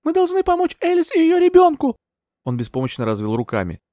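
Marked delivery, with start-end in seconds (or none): 0.1-2.0 high, loud appeal for help; very high, panicked cry. A narrator's reading. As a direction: beg for protection at a high pitch. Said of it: "крикнул я ему".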